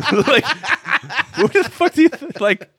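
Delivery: high-pitched, breaking into laughter; real laughter swallowing the words; laughing disbelief. Real, not acted. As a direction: squeal it out while cracking up. Cracking up at absurd line.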